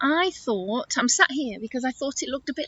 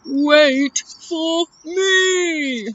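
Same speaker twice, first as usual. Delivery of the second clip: very slow, higher elephant wail; calling elephant; 5 semitones higher, 4 dB louder, much slower than usual. Acted. Elephant left behind calling to friends, slow drawn-out call. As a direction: stretch each word as a lumbering call. Eddie calls after the others.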